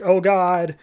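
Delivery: mock panic, pitch climbing; freaking out. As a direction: rookies panicking because the planet is missing, rising mock panic. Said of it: "are freaking out".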